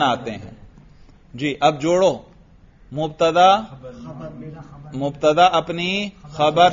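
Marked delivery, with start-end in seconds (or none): none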